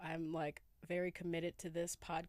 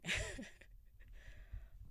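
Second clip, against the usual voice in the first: airy high giggle; a sleepy breathy laugh; 12 semitones higher, 6 dB quieter than usual. Real, not acted. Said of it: "I'm feeling kinda, kinda giggly".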